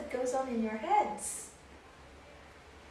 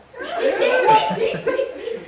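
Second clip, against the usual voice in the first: loud high burst of laughter; 13 semitones higher, 13 dB louder than usual. Real, not acted. Recalling the ambassador, high loud laughter.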